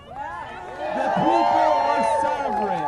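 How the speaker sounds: loud, slow proclamation; big drawn-out rally shout; rallying cry. Real, not acted. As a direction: declare it over a cheering crowd. Rallying cry over a cheering crowd.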